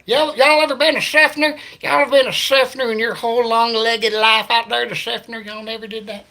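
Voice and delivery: loud mock Southern yokel. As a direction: ham up the small-town Florida twang. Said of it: "But I sure can imitate it".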